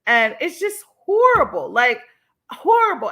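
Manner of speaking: shocked, rising outrage; outraged emphasis. Real, not acted. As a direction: push "horrible" up high in disbelief. Upset at abuse pictures.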